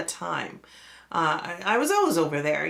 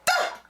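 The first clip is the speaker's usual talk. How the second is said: scare yell. Retold or imitated. Brother jumping out to scare.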